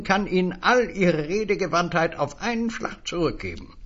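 a high, eager little stranger selling words; high, wheedling salesman voice. Acted. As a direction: pitch it up like a pushy little peddler. Stranger's long pitch.

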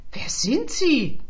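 high, loud, drawn-out outrage; indignant shout. A narrator's reading. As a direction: call out in indignation. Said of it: "rief Rita empört".

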